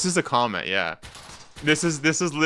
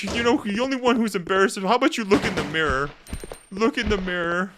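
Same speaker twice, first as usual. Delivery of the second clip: high-pitched mock indignation; imitating upset commenter; 9 semitones higher than usual. Streamer retelling a rage-baited old man's comment, mock upset. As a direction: lightly mimic an offended scolding. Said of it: "so upset".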